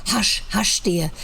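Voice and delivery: ordinary delivery, nothing marked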